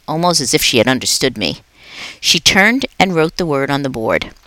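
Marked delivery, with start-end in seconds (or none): none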